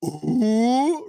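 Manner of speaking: a stung, drawn-out ooh; offended ooh. Retold or imitated. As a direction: player reacting to a kid's insult, offended ooh. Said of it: "when he goes".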